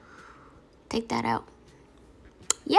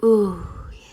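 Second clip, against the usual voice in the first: whispering; 3 semitones lower than usual. Real, not acted. Tasting chicken, whispered.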